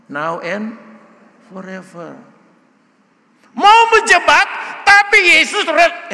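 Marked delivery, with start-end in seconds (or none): 3.6-6.1 booming, raised preaching voice; loud preaching. Real, not acted. Pastor booming at the congregation, loud. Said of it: "suara saya keras ya?".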